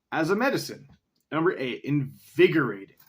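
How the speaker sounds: ordinary talk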